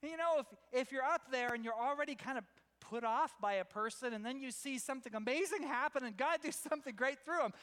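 ordinary talk, nothing marked

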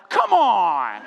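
loud mock-outraged outburst; exasperated shout. Preacher reacting to an unwelcome proverb, exasperated shout. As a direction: cry it out in comic protest.

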